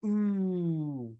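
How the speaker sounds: soft rounded ooh; teacher modelling the vowel ooh; ooh demo. Shown as a demonstration. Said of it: "Once more".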